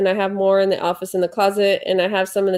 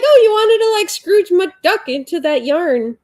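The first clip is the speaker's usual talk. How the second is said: excited high voice; 9 semitones higher, 4 dB louder than usual. Retold or imitated. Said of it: "So I'm like".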